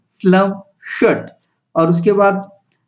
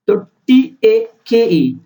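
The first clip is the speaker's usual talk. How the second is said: slow spelling out; 2 semitones higher, slower than usual. Shown as a demonstration. Teacher spelling टीएकेई slowly for the class. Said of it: "तो टी के लिए और एकेई के लिए एकेई".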